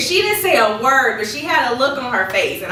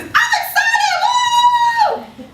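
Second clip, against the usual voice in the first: "I'm excited" much higher, louder and stretched out, then a cheer; truly excited; 5 dB louder, much slower than usual. Shown as a demonstration. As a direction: burst with real excitement.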